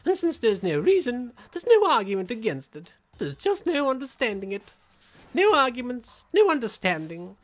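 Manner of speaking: gleeful villain. Acted. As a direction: savour every word of despair. Villain proclaims despair.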